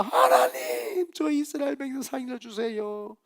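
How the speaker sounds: very high, long-drawn cry to God; imitated desperate prayer, softening as it goes; Moses crying out. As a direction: plead for the people's lives, starting on a wailing high note. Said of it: "모세가".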